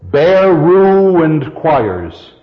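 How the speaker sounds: "Bare ruined choirs" very drawn out, stress on "ruined"; marking the accents. Shown as a demonstration. Teacher counting iambic accents, "ruined" stressed and stretched. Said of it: "What is the first accent".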